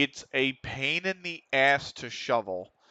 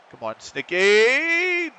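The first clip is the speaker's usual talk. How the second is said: higher, louder, very slow, drawn out; pleading call; 10 semitones higher, 5 dB louder, much slower than usual. Real, not acted. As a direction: beg the player to finally score. Urging player during match.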